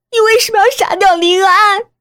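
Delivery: high, loud anguished scream; hysterical screaming. Acted. Daughter accuses mother of killing Lin An, screaming hysterically. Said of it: "我歇斯底里地叫着".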